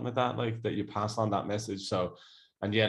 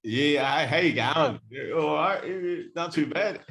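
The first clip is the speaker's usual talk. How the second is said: put-on breezy higher Australian accent, rising ends; 7 semitones higher, 4 dB louder than usual. Showing off his Australian accent on request. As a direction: play a laid-back Australian bloke. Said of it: "good Aussie accent, can't you, [name]?".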